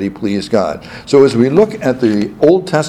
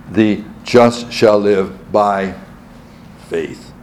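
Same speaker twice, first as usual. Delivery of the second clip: slow lead-in, pause, then "faith" set apart; stress on FAITH; slower than usual. Shown as a demonstration. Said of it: "And the third".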